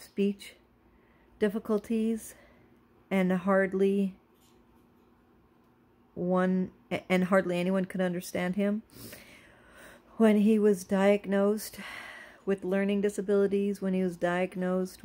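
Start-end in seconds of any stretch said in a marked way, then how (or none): none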